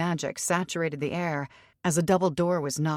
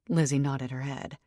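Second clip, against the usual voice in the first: ordinary delivery, nothing marked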